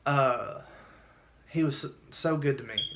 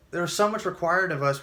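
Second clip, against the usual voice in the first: usual speaking voice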